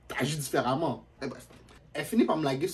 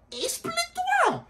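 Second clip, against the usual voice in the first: very high, slow drawn-out plea; exasperated whine; much slower than usual. Retold or imitated. Telling the girl to explain, high and slow. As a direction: stretch it out, squeaky and pleading.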